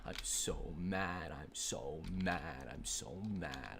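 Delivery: very slow, stretched-out sulking repetition; drawn-out whine. Real, not acted. Repeating complaint in drawn-out way.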